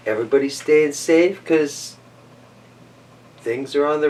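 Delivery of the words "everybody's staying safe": ordinary delivery, nothing marked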